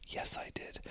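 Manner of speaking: tiny whispered admission; confessional aside. Acted. Answering own question about enjoying skipped exercise, hushed confession. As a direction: let the guilty answer slip out in a whisper.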